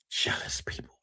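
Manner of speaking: breathy whisper, dropped right down. Real, not acted. Streamer whispering his verdict on jealous people to his viewers. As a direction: hiss it under your breath like a secret.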